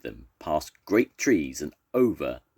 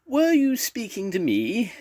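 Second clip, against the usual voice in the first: high, louder, polite monster question; gentle monster; 5 dB louder than usual. A narrator's reading. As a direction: ask politely, very high, not monstrous at all. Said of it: "in a voice that was not at all monstrous".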